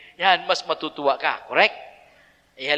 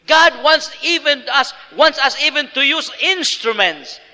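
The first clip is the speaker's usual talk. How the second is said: high, loud fervent preaching; loud, high-pitched sermon push; preaching at full force; 8 semitones higher, 7 dB louder than usual. Acted. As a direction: rouse the congregation with conviction.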